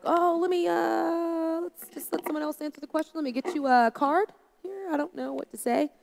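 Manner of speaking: high, nervous stalling to pass the buck; flustered dodge. Pretend. Pastor imagining dodging a visitor's question, high and flustered. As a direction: squirm out of the hard question. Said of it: "I could have just been like".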